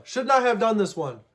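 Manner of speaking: usual speaking voice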